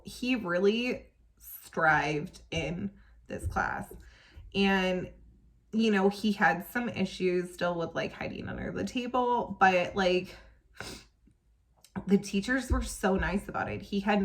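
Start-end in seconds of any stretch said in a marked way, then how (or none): none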